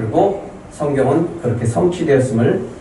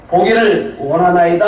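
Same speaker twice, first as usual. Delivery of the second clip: high, raised declaration of a wish; urgent plea; 5 semitones higher, 4 dB louder than usual. Acted. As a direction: answer out loud with urgency. Blind beggar answers Jesus urgently.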